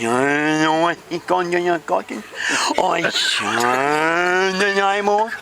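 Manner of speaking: high, garbled character voice; indignant Vicky. Acted. Vicky explains her friend's work, high garbled voice. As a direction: explain it all in a mangled put-on voice.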